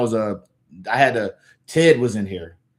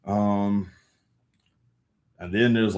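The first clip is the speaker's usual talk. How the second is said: ordinary talk, nothing marked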